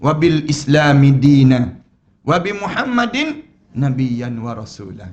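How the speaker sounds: slow, chanted Arabic supplication; solemn slow recitation of a doa; recited prayer. Shown as a demonstration. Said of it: "Rasulullah ajar pada kita sebut".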